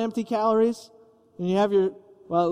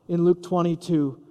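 ordinary delivery

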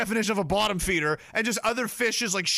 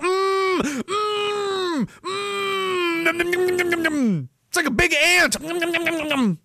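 much higher, hammed-up munching nom noms; mock gross eater; 10 semitones higher, slower than usual. Streamer mocking lobster eaters, hammed-up high munching. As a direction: play a greedy lobster eater smacking away.

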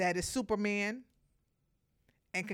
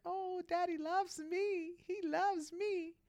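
much higher, slow, adoring little-pet voice; puppy voice; 12 semitones higher, slower than usual. Pretend. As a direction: coo like a blissful puppy. Puppy's happy inner voice.

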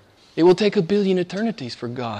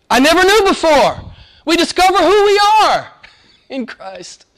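full-force preaching; 6 dB louder than usual. Acted. Preacher crying out the disciples' longing to the church, high and loud. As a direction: lift the congregation, stretching each phrase.

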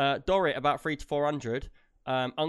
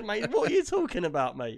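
mock twang; 5 semitones higher, 4 dB louder than usual. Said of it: "he's got a twang now".

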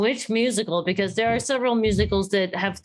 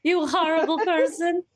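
accusing fan voice; 8 semitones higher than usual. Retold or imitated. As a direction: play a scandalised fan demanding musicals. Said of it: "And everyone would say".

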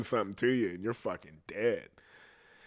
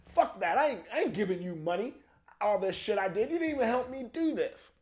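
higher, softer mock-indignant rant; put-upon complaint in a high, softened voice; indignant colonist; 6 semitones higher, 4 dB quieter than usual. Colonist refusing to share with the homeland, indignant.

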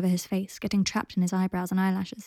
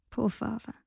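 soft murmur, softer than usual; 2 semitones higher, 7 dB quieter than usual. A narrator's reading. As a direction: murmur it gently to him. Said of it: "murmured Draco".

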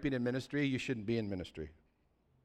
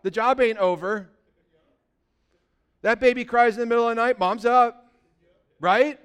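higher, louder preaching to the congregation; full-force preaching; 9 semitones higher, 11 dB louder than usual. Acted. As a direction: preach it out, hammering each point. Pastor preaching about moms.

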